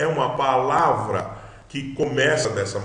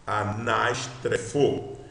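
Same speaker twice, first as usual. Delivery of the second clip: slow, deliberate pronunciation; sounding out a word; much slower than usual. Shown as a demonstration. Teacher repeats the Greek word, sounding it out slowly. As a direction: say "Anastrefo" slowly, in parts. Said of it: "Vamos ver".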